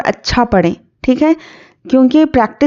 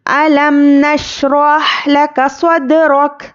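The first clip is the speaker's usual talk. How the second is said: slow, melodic, higher chanted recitation; Quran recitation; 3 semitones higher, much slower than usual. Acted. Teacher recites the surah for her students, melodic chant. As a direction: recite with long held melodic vowels.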